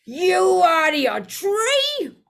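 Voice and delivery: high, loud alarmed yell; shrieking at his mate in shock. Acted. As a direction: bellow it in panic. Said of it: "yelled Stan".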